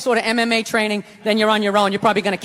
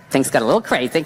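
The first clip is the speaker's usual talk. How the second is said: very low, subdued put-on voice; sheepish girlfriend imitation; 11 semitones lower than usual. Girlfriend phoning boyfriend after the fight, mock sheepish. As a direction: imitate a contrite admission, pitched way down.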